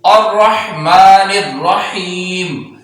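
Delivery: الرحمن الرحیم recited slowly, stopping on a silent final meem; final meem sakin. Shown as a demonstration. Teacher showing the pause with silent final letter. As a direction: hold الرحیم and close on a still meem. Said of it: "میم کو بھی ساکن کر دیں گے".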